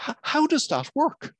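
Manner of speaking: a lightly voiced baffled husband; amazed question.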